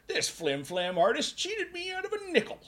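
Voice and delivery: high, loud grumpy prospector voice; old-time miner. Acted. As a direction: play a cranky old gold miner. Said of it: "make Jack into the old-time miner".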